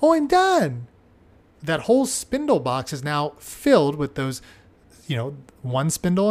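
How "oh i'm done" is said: put-on high, bright little child's voice, pitched well up; child announcing done. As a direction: play a proud small child. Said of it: "he would just say".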